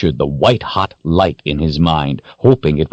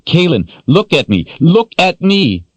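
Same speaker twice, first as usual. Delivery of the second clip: frantic calling; 12 semitones higher than usual. Acted.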